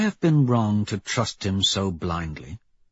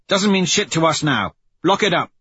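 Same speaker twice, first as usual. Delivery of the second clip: higher-pitched, forceful squad leader; Severo, curt leader; 7 semitones higher, 4 dB louder than usual. Acted. Severo cutting short the crew's speculation, commanding. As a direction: order the crew to get on with it. Voices Severo ordering the crew.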